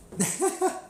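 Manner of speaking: high burst of laughter; helpless high giggle; real laugh. Real, not acted. Storyteller cracks up at his own prank, laughing.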